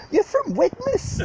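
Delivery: high, slow, drawn-out yell; mock shout at the telly. Fans at home shouting at [name] on telly, high mock yell. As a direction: jeer it at the screen like a heckler. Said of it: "We're, like, shouting at the telly".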